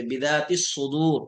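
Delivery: बिदातिस सुदूर with s doubled, no l; Quranic recitation demo. Shown as a demonstration. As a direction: say बिदातिस सुदूर, lam assimilated. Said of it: "बिदातिल सुदूर नहीं पढ़ते".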